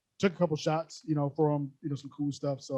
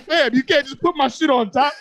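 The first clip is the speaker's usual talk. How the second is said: loud, high-pitched mock outrage; retold exasperation; 8 semitones higher, 8 dB louder than usual. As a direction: protest at full volume, exasperated but playing it for laughs. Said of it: "I'm getting, like, mad".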